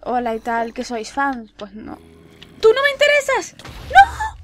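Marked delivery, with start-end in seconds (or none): 2.6-4.3 very high, loud shriek; panicked shout. Real, not acted. Player fending off unwanted attackers in the game, panicked shriek. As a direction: shriek them away. Rejecting approaching enemies in game.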